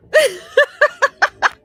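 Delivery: a high burst of real laughter. Real, not acted. Laughing at a chat comment, a high real laugh. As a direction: let a high laugh break out.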